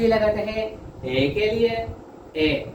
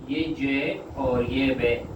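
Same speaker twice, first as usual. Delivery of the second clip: slow spelling; 4 semitones lower, slower than usual. Shown as a demonstration. Teacher spells out shorthand letters.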